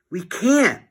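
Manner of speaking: put-on quick boyish protest; Harold, lightly voiced. A narrator's reading. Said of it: "said Harold".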